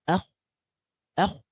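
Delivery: أخ with a full-mouth kha; heavy kha. Shown as a demonstration. Teacher demos sukun kha to class: full-mouth أخ. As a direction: fill your mouth on أخ. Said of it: "Like you're filling your mouth with the sound".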